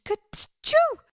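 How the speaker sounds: a sudden rabbit sneeze; a high, squeaky sneeze; big sneeze. A narrator's reading. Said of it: "Peter sneezed".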